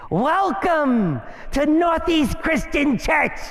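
high, slow, exaggerated church welcome; passive-aggressive niceness. Churchgoer hiding nastiness greets visitors, mock-nice and high. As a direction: put on an over-sweet, drawn-out greeter voice. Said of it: "Passive aggression".

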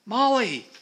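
high, loud cry of a name; calling out. A narrator's reading. Trapped Peter calling up to Molly, crying out. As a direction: cry out to a rescuer.

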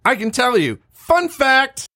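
high, loud burst of excitement; loud excited. Real, not acted. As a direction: blurt out the fun fact. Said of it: "that was loud".

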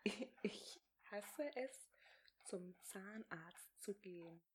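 slow, voiceless whisper, word by word. Shown as a demonstration. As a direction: whisper it slowly and clearly for lip-reading. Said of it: "Nochmal, was?".

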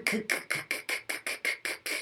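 k said as a whispered puff, no voice; unvoiced k. Shown as a demonstration. Teacher demoing the voiceless k to students, whispered. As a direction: repeat a breathy voiceless k, softly. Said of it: "No vocal cords".

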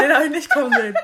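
can't stop laughing over the words. Real, not acted. Laughing hard after her friend's joke. Repeats punchline laughing loudly.

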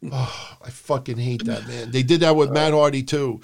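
ordinary talk

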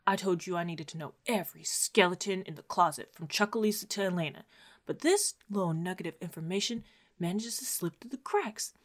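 quiet, breathy whisper of a furious boss; mock whisper; hushed whisper. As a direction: hiss it low behind closed doors. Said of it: "he's like".

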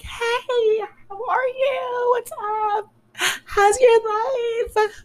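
very high, drawn-out mock greeting; fake friendly. Greeting an acquaintance she was ignoring, fake friendly. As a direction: squeal a put-on hello, stretching the words.

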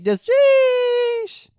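high, loud squeaky sheesh; mocking kid voice. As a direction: blurt it like a bratty teen. Mocking kids' slang again.

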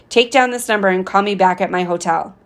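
usual speaking voice

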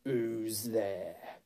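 slow growled challenge; growling wolf. A narrator's reading. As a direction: growl it softly, drawn out, like a wolf. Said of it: "said in a growling voice".

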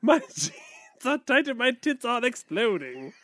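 high, loud mock Shakespearean; hammy stage actor. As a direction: declaim like a ham actor. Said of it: "is that [name] or Shakespeare".

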